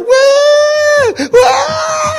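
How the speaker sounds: very high, loud wailing whoops; imitated yell. Healed boy runs circles round the building, imitated yelling. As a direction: shriek like a boy running wild.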